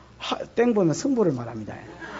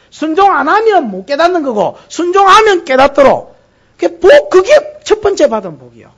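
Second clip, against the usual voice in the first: fervent preaching; 9 semitones higher, 10 dB louder than usual. Acted. Preacher to congregation on Abraham, loud fervent preaching.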